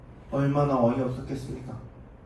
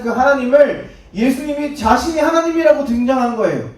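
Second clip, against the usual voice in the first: preaching; 7 semitones higher, 6 dB louder than usual. Acted. Preacher builds emphasis.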